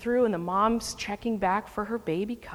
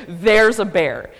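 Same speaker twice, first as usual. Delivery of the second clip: loud warning; 9 dB louder, slower than usual. Retold or imitated. Repeating warning to the group.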